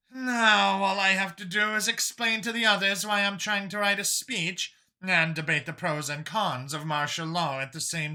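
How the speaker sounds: lightly tired official; same sigh. Acted. Kuyina dreading explaining to the council, lightly weary. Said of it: "said with that same sigh".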